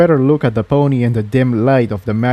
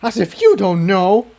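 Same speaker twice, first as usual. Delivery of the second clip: spat; 8 semitones higher than usual. Acted. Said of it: "spat the third earth pony".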